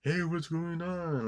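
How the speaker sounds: high, slow call from a distant neighbor; imagined neighbor calling. Pretend. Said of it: "you don't even hear anybody saying".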